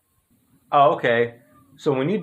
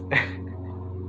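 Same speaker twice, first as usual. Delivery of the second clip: laugh; 6 dB quieter than usual. Real, not acted. Laughs at the name.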